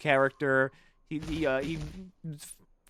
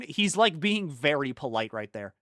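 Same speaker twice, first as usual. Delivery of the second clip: usual speaking voice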